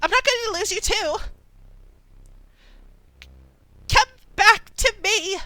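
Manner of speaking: high, ringing commanding call; resonant with power. Acted. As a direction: summon him back with power. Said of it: "her voice resonates with power as she speaks".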